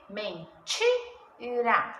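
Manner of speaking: "Mentira" very slowly as men, TI, ra; stressed syllable demo. Shown as a demonstration. Teacher confirms ti is tonic, "Mentira" split men-TI-ra. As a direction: break "Mentira" into three parts with gaps.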